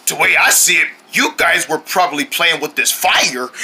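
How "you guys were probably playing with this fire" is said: ordinary talk, nothing marked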